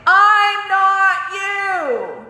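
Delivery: high, slow, drawn-out scream; shouted slowly at the top of the lungs. Retold or imitated. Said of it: "I screamed it at the top of my lungs".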